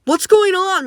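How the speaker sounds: high urgent call into the room; shout. Acted. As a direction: burst in demanding answers. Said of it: "Medic shouts as he enters".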